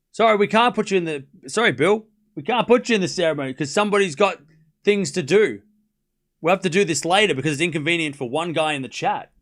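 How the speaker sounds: hammed-up official apology; sarcastic mock apology. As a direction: apologize loudly on behalf of a fussy complainer. Mock apology to honoree for chatter.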